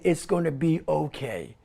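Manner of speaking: hushed, comforting inner voice; comforting mommy voice. Acted. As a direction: reassure softly, like a mother holding a child. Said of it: "that very comfortable voice, that, that's that mommy holding you".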